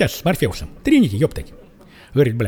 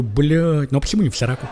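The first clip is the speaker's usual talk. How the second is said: normal talking voice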